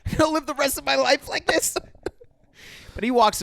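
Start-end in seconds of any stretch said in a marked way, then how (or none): 0.0-1.8 mock dying alien whine. Friends retelling E.T. scene, high mock whine. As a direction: whimper it up high like a wounded alien.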